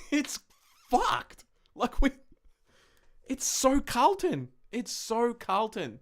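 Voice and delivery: high giggly disbelief, laughing through the words. Real, not acted. Fan to viewers about his team, laughing in disbelief. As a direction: laugh at how absurd it is. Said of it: "I just feel like laughing".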